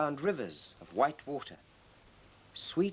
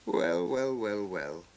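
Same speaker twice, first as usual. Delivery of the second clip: laughing; 5 semitones lower, slower than usual. A narrator's reading. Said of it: "laughed Father Christmas".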